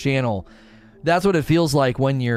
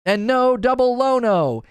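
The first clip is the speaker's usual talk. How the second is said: playful; 10 semitones higher, slower than usual. Real, not acted. Playful sign-off tease.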